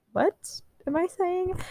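high, slow self-check; surprised. Real, not acted. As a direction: catch yourself mid-sentence. Catches own misreading.

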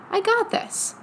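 high, light burst of self-belief; self-assured inner voice. Acted. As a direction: pipe up as your confident inner self. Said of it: "the part of me that's like".

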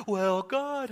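a hint of a sing-song pious preacher; lightly mock the glib preacher's high piety. Preacher mocking glib preachers, lightly pious tone. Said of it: "those preachers that go".

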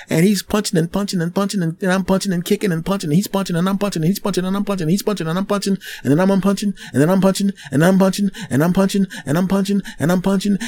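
breathless fight rush; rapid-fire fight. Retold or imitated. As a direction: rattle it off like blows. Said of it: "fight frenzy".

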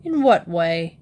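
lightly voiced suspicious official; suspicious question. A narrator's reading. Official questioning Mister Brown about the name, suspicious. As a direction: probe with mild suspicion. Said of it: "repeated the man suspiciously".

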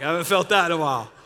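loud, quick retold amazement; startled exclamation. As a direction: blurt it out loudly, as if jolted. Retells startled reaction to shaking legs.

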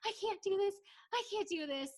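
high-pitched imitation of a sobbing contestant; retold sob, played for laughs; mock sobbing. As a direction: put on a tearful wail, lightly hammed up. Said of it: "Brandi is sobbing uncontrollably".

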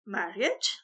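a touch of shock in one word; mother recoils at the news, lightly; horrified. A narrator's reading. Mother hearing her son will marry, mildly horrified. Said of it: "She sounded horrified".

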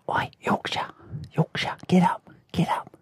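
whispered London wake-up call; whispering. Retold or imitated. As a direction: whisper urgently to wake a mate. Lee waking him in dreams.

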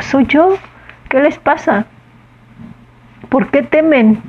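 gently in charge, calming scared friends; calm authority. Retold or imitated. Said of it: "pero con una autoridad bárbara". (Jesús calmando a los discípulos con autoridad serena)

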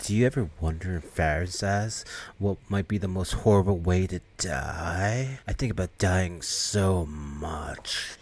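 slow, soft and morbid; morbid dreamy voice. Retold or imitated. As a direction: drift through it like a gloomy goth. Morbid goth girl muses on death.

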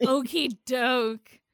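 slow, drawn-out Midwestern okie doke. Retold or imitated. Mocking Emily's line in a slow Midwestern twang. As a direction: stretch it out Midwestern style, slowly. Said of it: "No need to go full Midwestern".

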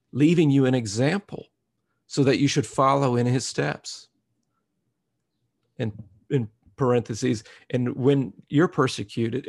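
loud, climbing preacher's cadence; preaching at full force. Acted. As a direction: preach it out to the whole congregation. Preacher quoting scripture.

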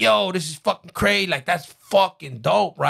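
high, hyped-up reenactment; stretched-out excited hype. Retold or imitated. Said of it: "I'm excited at this point".

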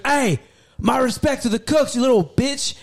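high-pitched imitation of a satisfied drunk; drunk customer calling out. Drunk diner salutes cooks, insults waiter, mock rowdy praise. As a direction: call out rowdy praise to the kitchen.

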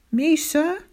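high, rising, taken aback; startled question. A narrator's reading. Singled-out pupil to shouting teacher, startled.

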